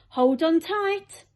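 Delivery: high, slow excited call; excited warning. Acted. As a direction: urge everyone to brace, thrilled. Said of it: "hopping up and down with excitement".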